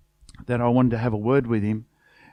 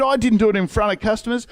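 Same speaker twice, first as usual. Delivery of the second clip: defensive excuse; 8 semitones higher, faster than usual. Retold or imitated.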